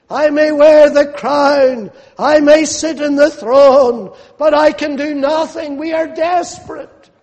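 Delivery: high, loud cry of a desperate king; impassioned preaching. Acted. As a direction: preach the king's helplessness with rising force. Preaches the king's despair.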